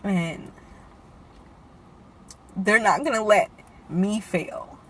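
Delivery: quiet, halting, fighting back tears; pausing long to keep from crying; holding back tears. Real, not acted. To viewers about her mother, holding back real tears. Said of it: "and I'm trying not to cry".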